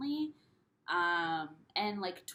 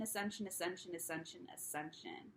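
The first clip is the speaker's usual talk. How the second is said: fading echo; 9 dB quieter than usual. Real, not acted. Speaker echoing the endless pattern of ascension, fading away. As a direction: repeat the word, softer each time.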